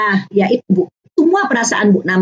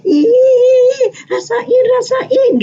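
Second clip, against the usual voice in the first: taunting classmate; 12 semitones higher, much slower than usual. Retold or imitated. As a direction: tease like a nasty schoolkid. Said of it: "teman-temanku bilang gini".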